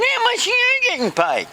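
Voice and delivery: very high put-on voice; garbled character voice. One friend asks the other's pay, high garbled voice. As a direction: ask it high with mangled consonants.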